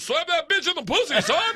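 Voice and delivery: loud, high-pitched comic yell; hyped inner voice. Acted. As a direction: egg yourself on like a manic cheerleader. Voice in his head eggs him on.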